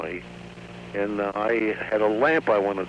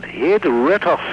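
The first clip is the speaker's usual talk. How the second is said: put-on high, loud caricature Japanese accent; comic Japanese grocer's accent, pitched well up; Japanese dialect; 7 semitones higher, 5 dB louder than usual. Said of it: "that's a Japanese dialect".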